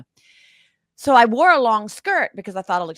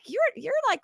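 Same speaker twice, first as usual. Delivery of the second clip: high mock incredulous voice; lightly mocking the puzzled staff; restaurant staff. Restaurant staff doubting her as waitress, mock high disbelief. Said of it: "they looked at me like".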